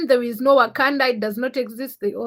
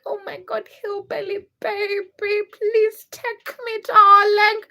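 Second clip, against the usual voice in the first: high-pitched, drawn-out fake swoon; mock swooning; 9 semitones higher, 4 dB louder, slower than usual. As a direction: play a hammed-up lovestruck plea. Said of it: "then I'll be like".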